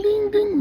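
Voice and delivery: high sing-song ding ding; imitated phone ding. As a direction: chime it like a phone. Phone going off with messages.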